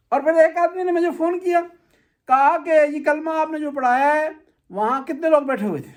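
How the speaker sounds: normal talking voice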